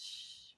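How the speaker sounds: soft, drawn-out shush; voiceless hushing sound; hushing shh. Acted. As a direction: hush them with a shh. Cow hushes the others.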